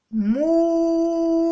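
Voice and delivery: long sustained مو with a held vowel; drawn-out syllable mu. Shown as a demonstration. Teacher answering her own question, drawing out the syllable. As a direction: hold مو steady like a model answer. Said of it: "الماء واش تولي".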